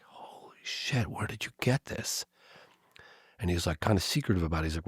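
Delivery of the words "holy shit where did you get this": slow, breathless whispered "holy shit"; retold stunned whisper to a friend; shocked whisper. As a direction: hiss it under your breath in disbelief. Stunned aside to friend Chuck.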